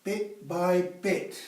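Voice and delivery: "Bit by bit" said very slowly; slow punchline delivery; slow emphasis. Shown as a demonstration. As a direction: spell out "Bit by bit" word by word. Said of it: "How do you eat an elephant?".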